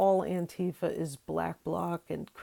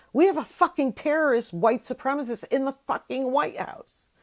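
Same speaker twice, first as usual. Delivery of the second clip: loud, higher, angry cursing; furious outburst; 6 semitones higher, 5 dB louder than usual. Real, not acted. Ranting vlogger erupts in anger at her viewers. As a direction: spit out the outrage at the president.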